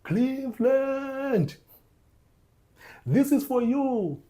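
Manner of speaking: hoog, langzaam, uitgerekt geroepen; LeBron's dedication. Retold or imitated. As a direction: roep het als een emotionele kampioen. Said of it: "And then he continued to say".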